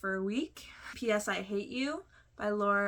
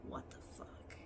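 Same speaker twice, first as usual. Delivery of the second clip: whispered, breathless disbelief; stunned whisper; 16 dB quieter than usual. Retold or imitated. Whispering a sister's shocked reaction to learning her secret. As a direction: hiss it under your breath, shocked. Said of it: "she's just like".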